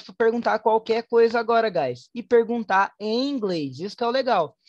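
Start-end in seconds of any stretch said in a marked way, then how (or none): none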